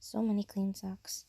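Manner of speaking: soft, hushed admiring whisper. Real, not acted. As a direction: whisper over the clean socks. Admiring fresh laundry.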